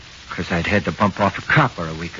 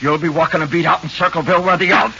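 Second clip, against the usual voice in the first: loud, high and fast threat; angry threat; 7 semitones higher, 4 dB louder than usual. Acted. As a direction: rattle off the threat in a rage. Suspect threatens the cop.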